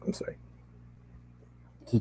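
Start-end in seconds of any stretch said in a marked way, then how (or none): 0.1-0.4 whispered apology. Real, not acted. Reader apologizing softly.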